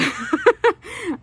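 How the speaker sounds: loud squealing laugh; real giggling burst; high burst of laughter. Real, not acted. Laughing at own story.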